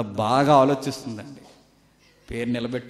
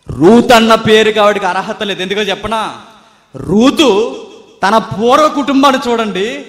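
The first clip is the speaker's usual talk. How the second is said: loud, higher preaching to the congregation; full-force preaching; 7 semitones higher, 13 dB louder than usual. Acted. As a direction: drive the point home from the pulpit.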